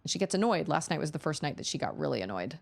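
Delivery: normal talking voice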